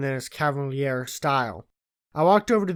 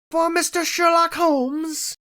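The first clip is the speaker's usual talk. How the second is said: much louder, higher, slow called-out delivery; bellow; 6 dB louder, slower than usual. A narrator's reading. Messenger announcing a letter at the door, loud slow bellow. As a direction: boom the name out from the doorway. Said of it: "a deep voice bellow".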